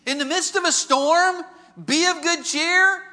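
high, drawn-out disbelief; incredulous question. Acted. Listener challenges the preacher's verse, incredulous. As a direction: question it loudly, as if it were absurd.

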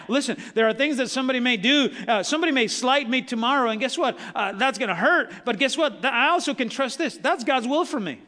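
ordinary talk

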